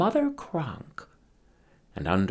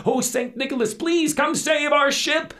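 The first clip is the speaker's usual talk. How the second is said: much higher, louder wailing plea; desperate prayer; 5 dB louder than usual. Acted. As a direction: cry to the saint as the ship sinks. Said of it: "they began to wish and pray".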